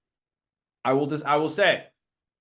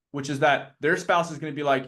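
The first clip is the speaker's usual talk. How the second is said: usual speaking voice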